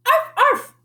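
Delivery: high yappy arf arf; playful barking. Acted. Little sister yapping like a puppy, high and playful. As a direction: yap like a little dog. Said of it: "barked Jujubee".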